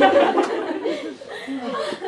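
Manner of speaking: high laugh breaking out; can't hold the laugh in; loud giggle; burst of laughter. Real, not acted.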